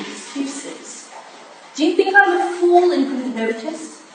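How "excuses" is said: breathed out, barely voiced, scornful; bitter whispered dismissal. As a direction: spit the word out under your breath. Wife confronts husband about secrets.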